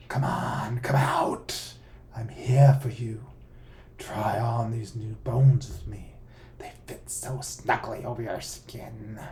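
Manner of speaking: slow, coaxing monster voice; a hint of a sinister lure; creepy boogeyman voice. A narrator's reading. Boogeyman calls from outside.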